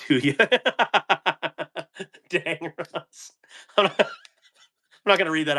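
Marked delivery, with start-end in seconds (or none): 0.4-2.0 high giggle. Real, not acted. Reading a funny chat comment.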